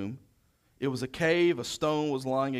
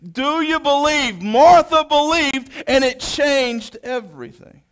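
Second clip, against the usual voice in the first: loud, high, ringing proclamation; preaching at full volume, building to a call; full-force preaching; 8 semitones higher, 11 dB louder than usual. Acted. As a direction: proclaim it to the whole congregation.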